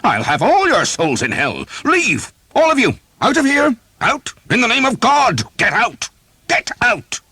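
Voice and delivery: very high, loud furious yells; roaring priest. A narrator's reading. Priest wielding a poker, roaring them out. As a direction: drive them all out. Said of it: "whose voice was loud enough".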